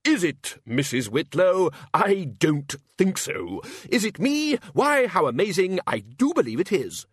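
high, slow mock questioning, then mock amazement; sarcastic Archchancellor. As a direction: feign astonishment at your own authority. Archchancellor's sarcastic self-answer.

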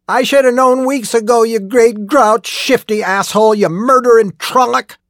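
high, loud raging accusation; furious shout. A narrator's reading. Old miner cursing the murderer he has pinned, furious shout. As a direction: hurl the insults at full pitch. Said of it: "he shouted".

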